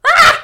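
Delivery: short, loud high shriek; mock shriek of sudden pain. A narrator's reading. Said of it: "She shrieked as she felt the pain".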